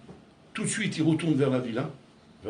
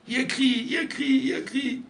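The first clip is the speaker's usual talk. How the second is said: mounting cry; 10 semitones higher than usual. A narrator's reading. Mother screaming in the fire.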